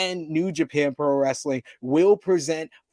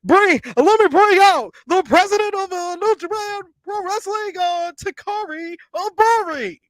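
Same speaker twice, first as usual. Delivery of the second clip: very high, loud, over-the-top announcer impersonation; Tony Khan; 8 dB louder than usual. Acted. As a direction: squeal out a hammed-up, hyped introduction. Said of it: "in my best Tony Khan impersonation".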